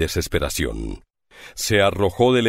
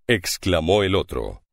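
ordinary delivery

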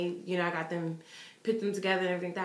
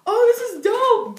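put-on higher, louder, slow kid voice; imitating an excited boy; 12 semitones higher, 8 dB louder, slower than usual. Boy pulling the shirt from his bag, excited. Voicing boy opening gift.

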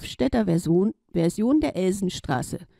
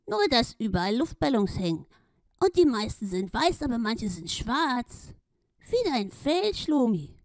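duck voice; 3 semitones higher than usual. Acted. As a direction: talk as the little duck. Duck describes the balloons.

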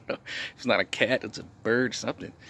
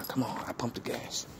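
softer, whispered begging to the engine; whispered plea; 9 dB quieter than usual. Real, not acted. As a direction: breathe a plea at the car. Begging the car to start.

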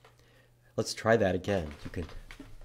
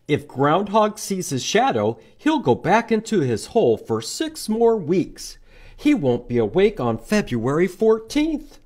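much higher, squeaky little bunny; cheerful high bunny chirp; 9 semitones higher, 6 dB louder than usual. Acted. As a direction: pipe up like a tiny bunny. Bunny explains in picture book.

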